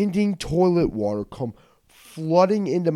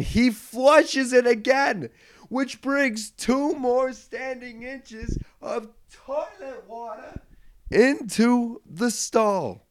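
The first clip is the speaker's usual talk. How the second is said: high, slow, strained wail; high exasperated wail, dying away then back; horrified disbelief; 11 semitones higher, much slower than usual. Retold or imitated. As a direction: stretch each word out in comic horror. Horrified climax of the story.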